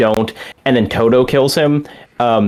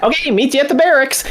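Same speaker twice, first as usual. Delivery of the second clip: high, quick, squeaky sign-off; squeaky game character; 13 semitones higher, faster than usual. Retold or imitated. Imitating Todo once the mission ends, high and squeaky. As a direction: imitate a perky squeaky voice, pitched way up. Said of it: "he's back to like".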